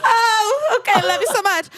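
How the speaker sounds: very high, weepy pretend goodbye; hammed-up crying teen; mock tearful. Rejected teen saying goodbye to driver, mock tearful. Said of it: "I'd immediately be crying".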